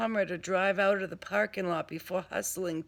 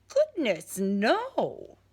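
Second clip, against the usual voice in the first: mock shock; 5 semitones higher, 5 dB louder, much slower than usual. Mock appalled Mayhem.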